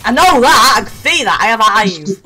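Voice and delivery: loud, high, indignant retort; exasperated yell. Real, not acted. Gamer told where opponent is, exasperated shout. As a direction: snap back at full volume. Annoyed retort to the other player.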